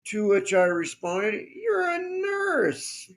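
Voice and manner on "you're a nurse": very high, drawn-out disbelief; high-pitched, exaggerated incredulous reply. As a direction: stretch it out in mock astonishment. Retold incredulous reply to squeamish nurse.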